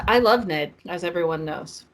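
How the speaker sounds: ordinary talk, nothing marked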